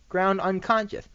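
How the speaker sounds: usual speaking voice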